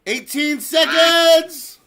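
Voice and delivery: high, loud, drawn-out call; slow announcer's yell; shouted countdown. Real, not acted. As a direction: holler the time left, stretching it out. Calls the race countdown.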